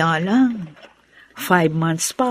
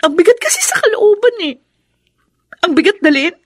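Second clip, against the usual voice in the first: higher, louder, voice straining with grief; strained heartache; 10 semitones higher, 6 dB louder than usual. Acted. Woman confiding her heavy heart to Wendy, straining with grief. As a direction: pour out the burden to her friend.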